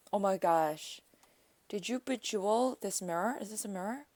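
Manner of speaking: ordinary delivery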